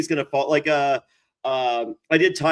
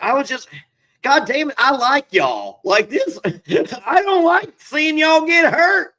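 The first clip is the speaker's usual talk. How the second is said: Ty Murray; 12 semitones higher, 7 dB louder than usual. Retold or imitated. Bull rider telling the cast he likes them, imitated loud outburst. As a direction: imitate a worked-up rider hollering at people he likes. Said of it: "Ty Murray's furious".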